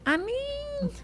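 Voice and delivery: soft reluctant refusal; mineiro "ah, nem". Shown as a demonstration. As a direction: whine "Ah, nem" up high. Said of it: "Já ouviu o nem?".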